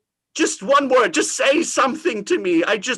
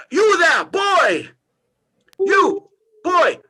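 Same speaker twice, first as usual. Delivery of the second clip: calling out; 6 semitones higher than usual. Acted. Scrooge shouting from his window to a boy below, high call.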